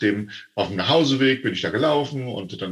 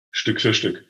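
punchline. Shown as a demonstration. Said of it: "Wie isst man einen Elefanten?".

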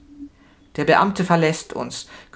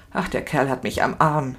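plain reading voice

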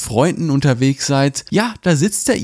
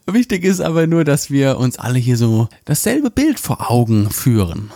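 ordinary talk

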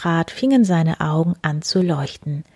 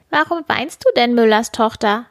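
high, lightly voiced little man; a hint of a curious little man; little man's voice; 6 semitones higher, 3 dB louder than usual. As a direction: ask like a small odd mannikin. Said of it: "fragte es".

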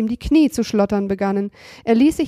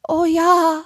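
higher boyish voice with a mock groan; 9 semitones higher, much slower than usual.

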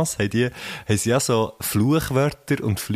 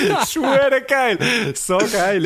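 high, breaking up with laughter; laughing; 10 semitones higher, slower than usual. Real, not acted. Delighted by the old French textbook memory, laughing hard. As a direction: say it through real laughter.

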